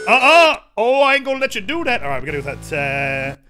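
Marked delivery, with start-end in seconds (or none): 0.1-2.0 loud refusal. Real, not acted. Blocking an enemy attack.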